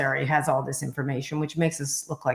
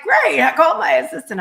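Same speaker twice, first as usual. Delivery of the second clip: put-on much higher, louder, eager old mogul; eager Murdoch; 11 semitones higher, 8 dB louder than usual. Murdoch cheerfully says his assistant will arrange lunch. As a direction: chirp it like a delighted boss. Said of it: "he's like".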